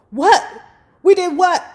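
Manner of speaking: desperate to know what happened; frantic. Retold or imitated. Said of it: "I was frantic".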